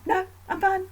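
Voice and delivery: high-pitched, put-on denial; falsely fine. Wife hiding upset from husband, falsely fine. Wife denies anything is wrong.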